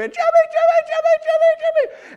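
very high, loud chanted yelling; high frantic name calls; screaming. Retold or imitated. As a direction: crowd shrieking a name. Crowd screaming for Jimmy.

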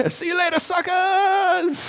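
high, gleeful parting call; mock taunt. Just running from the fire, mock taunting farewell. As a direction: toss it back as you flee, hammed-up. Joking runaway calls back.

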